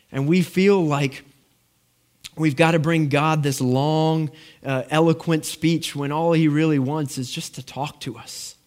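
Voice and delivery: normal talking voice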